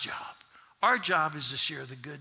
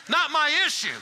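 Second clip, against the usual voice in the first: much higher, louder call; shouted; 10 semitones higher, 5 dB louder than usual. Shown as a demonstration. Preacher leading the crowd in a shouted catchphrase. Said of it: "somebody shout out there".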